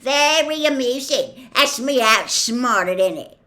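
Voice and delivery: high, loud sarcastic outburst; gruff, rough-accented scorn; growled. A narrator's reading. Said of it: "growled Hagrid".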